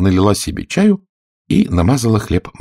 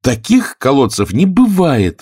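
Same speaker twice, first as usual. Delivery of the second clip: indignant Alice; 7 semitones higher, 4 dB louder, slower than usual. Acted. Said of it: "возмущённо закричала Алиса".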